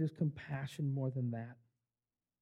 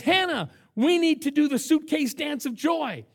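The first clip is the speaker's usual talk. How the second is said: put-on higher, louder, eager boy's pitch; bursting with a kid's excitement; excited kid; 8 semitones higher, 6 dB louder than usual. [name] urging Hannah to celebrate, excitedly. Boy excitedly proposes joy dance.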